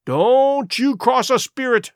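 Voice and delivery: high, slow, dread-filled caution; fearful warning. A narrator's reading. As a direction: warn him off, stretching the words. Scared pirate warns Silver.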